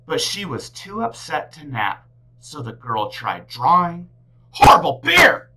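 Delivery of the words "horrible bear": high loud frustrated shout; angry yell. A narrator's reading. Upset girl yelling while trying to draw. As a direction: blurt it out in frustration.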